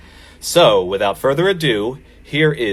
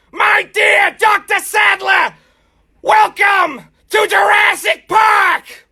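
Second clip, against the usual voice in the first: far higher, louder, slow squawking shout; Gilbert Gottfried impression; 4 dB louder, slower than usual. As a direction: yell every line in a grating, squinting squawk. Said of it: "all the parts are Gilbert Gottfried".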